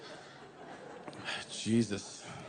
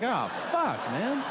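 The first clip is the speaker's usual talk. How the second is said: dismayed realization; 8 semitones higher, slower than usual. Retold or imitated. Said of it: "And I'm like".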